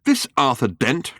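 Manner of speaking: ordinary delivery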